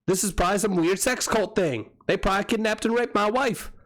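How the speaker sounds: high-pitched, urgent mock report to police; hammed-up alarmed caller; urgent plea. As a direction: plead for backup, comically worked up.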